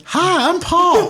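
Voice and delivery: high, slow, laughing friendly hello; cheery high greeting. Acted. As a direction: say it like a harmless nice guy. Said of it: "you wait for a roar, and it's".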